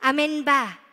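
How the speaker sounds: ordinary talk, nothing marked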